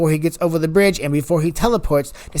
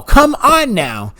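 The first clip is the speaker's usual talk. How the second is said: ordinary talk, nothing marked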